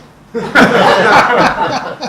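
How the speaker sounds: big loud laugh at his own punchline; loud burst of laughter. Real, not acted. Laughing hard at his punchline to the audience, big loud laugh. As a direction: roar with laughter. After "You're fired" punchline.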